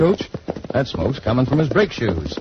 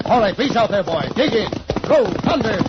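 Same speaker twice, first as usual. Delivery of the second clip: higher, driving calls to the horse; urging his horse; 6 semitones higher than usual. Acted. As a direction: push the horse on hard. Urging horse alongside the coach.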